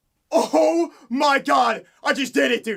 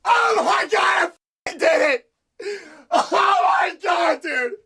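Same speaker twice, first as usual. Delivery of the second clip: whooping yells of real triumph, pitched up; ecstatic shouting; 3 semitones higher than usual. Real, not acted. Streamer to chat after the big win, ecstatic shouts. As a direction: shout it out like you just won. Streamer celebrates finishing his run.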